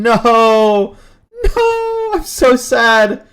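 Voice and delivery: high, loud wail of dismay; dismayed cry. Real, not acted. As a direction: wail No at the broken overlay. Overlay broken live on stream.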